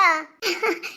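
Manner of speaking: short, low relieved chuckle; laugh. Acted. Wife learning of husband's plan, short low laugh. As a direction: let out a little surprised laugh.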